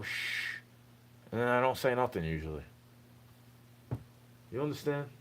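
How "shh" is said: a short unvoiced shush; breathy shush. Asking someone to quiet down, soft shush. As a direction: hush them without sound. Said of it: "I go".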